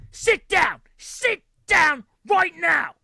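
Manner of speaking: furious high yelling at his family; screaming at his kids in the restaurant; angry shout. Retold or imitated. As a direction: bellow it like an enraged dad. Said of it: "screaming at, like, his daughter".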